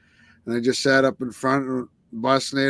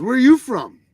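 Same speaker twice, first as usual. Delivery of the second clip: retold demand; 10 semitones higher, 8 dB louder than usual. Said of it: "he goes".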